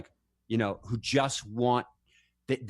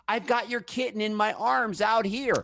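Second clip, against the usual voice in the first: mother calling; 8 semitones higher than usual. Retold or imitated. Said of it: "your mother calls in and says".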